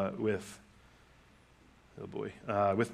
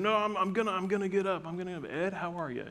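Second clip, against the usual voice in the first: put-on old grandfather; 9 semitones higher than usual. Grandfather insisting he'll stand for his visitor, high old-man voice. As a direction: play the ninety-eight-year-old insisting on rising. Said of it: "He's like".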